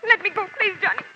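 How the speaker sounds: frightened woman begging the detective to release her arm; frightened pleading. Acted. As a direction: plead to get free of his grip. Woman struggling in his grip.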